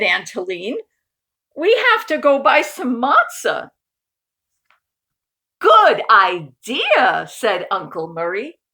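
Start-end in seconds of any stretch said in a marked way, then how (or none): 1.5-3.7 higher, louder urgent call; urgent aunt. Acted. Aunt Helene urging Uncle Murray to shop, higher and louder. 5.6-7.3 slow, loud drawn-out good idea; hearty refrain. Acted. Uncle Murray's catchphrase agreement, slow and loud.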